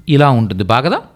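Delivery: ordinary talk, nothing marked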